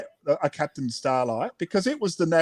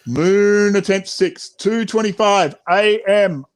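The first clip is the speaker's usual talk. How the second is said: slow, louder mission log call; announcer log; 3 semitones higher, 5 dB louder than usual. Acted. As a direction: announce the attempt like a space mission. Announcing attempt.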